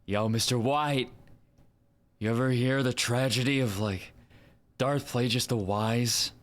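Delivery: casual dude pitching a story; Aaron Paul. Acted. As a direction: call out to your old partner like a slacker. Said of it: "actually do sound like Aaron Paul".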